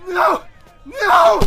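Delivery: very high, loud struggling cries; boy struggling to break free; frantic screams. Acted. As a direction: scream No as you fight. Boy struggles against the guard.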